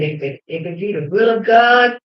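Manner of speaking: half-sung, shouted vow; 1 fervent shout. Retold or imitated. Woman vowing to her children, loud and half-sung. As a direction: belt it out like a preacher.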